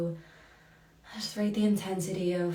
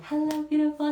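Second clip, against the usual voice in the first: much higher and louder, a bright sing-song hello; high joyful greeting; 10 semitones higher, 5 dB louder than usual. Real, not acted. Host joyfully greets a viewer in chat, high sing-song.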